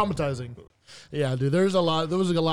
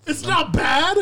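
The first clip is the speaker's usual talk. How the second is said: high, mocking repeat as a question; scandalized, pitched-up echo; incredulous echo; 8 semitones higher, much slower than usual. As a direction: throw the words back in disbelief. Throws friend's words back.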